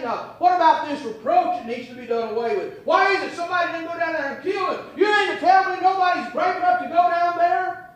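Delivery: high, fast indignant shout; mocking outrage. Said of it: "He's standing over there saying".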